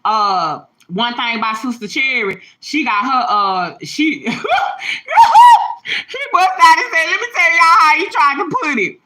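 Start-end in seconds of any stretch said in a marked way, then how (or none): 4.3-6.3 shrieking burst of laughter; cracking up loudly; high howling laugh. Real, not acted. Big laugh telling church story. 6.3-9.0 talking through laughter; can barely get it out for laughing; high, loud laughing speech. Real, not acted. Laughing while retelling bishop.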